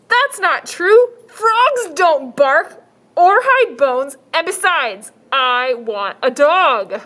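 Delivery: put-on high, slow, firm little girl. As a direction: insist like a stubborn child.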